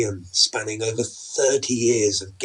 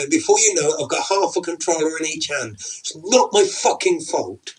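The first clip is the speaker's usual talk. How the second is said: angry rant; 6 semitones higher, 4 dB louder than usual. Acted. Husband rants about the game.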